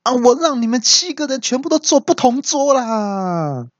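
very high, hammed-up angry cry; enraged yell. Groom hears seven guests are coming, mock-enraged yell. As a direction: wail it on one high pitch, last word stretched. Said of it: "气到大叫说".

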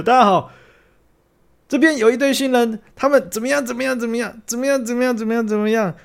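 high-pitched hammed-up host announcing the couple; rally the crowd like a wedding emcee; over-the-top announcer pitch; mock emcee speech. Shown as a demonstration. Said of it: "好比说你就会讲说".

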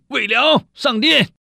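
high, loud call across the hall; court herald's call. Acted. Herald calls the official to court, loud shout. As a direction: summon him loudly into the palace.

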